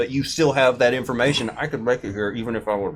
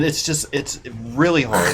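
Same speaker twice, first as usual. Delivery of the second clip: ordinary talk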